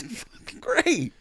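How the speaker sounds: high, slow, dripping sarcasm; mock delight, drawn out and high; a fed-up guest's high, slow sarcasm; sarcastic. Sarcastic reaction after service ends.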